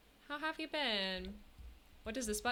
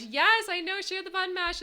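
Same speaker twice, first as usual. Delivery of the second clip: loud, high, excited burst; excited gushing; 9 semitones higher, 9 dB louder than usual. Real, not acted. Streamer telling chat about the button mash emote, excited.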